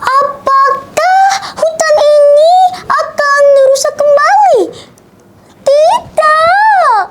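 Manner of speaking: very high, tearful cry of alarm; Ciko panicking. A narrator's reading. Ciko fearing the forest's destruction, high panicked wail. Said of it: "kata Ciko dengan panik".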